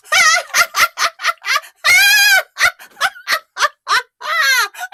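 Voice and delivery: high shrieking cackle, loud; boy's wild laugh. A narrator's reading. Prankster boy cackling at the fooled villagers. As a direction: laugh hysterically like a prankster kid. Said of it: "he was laughing so hard".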